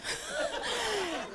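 high, quiet laughing; burst of laughter. Real, not acted. Laughing at the actor's gravy joke.

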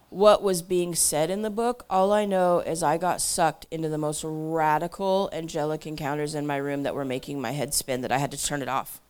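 ordinary delivery

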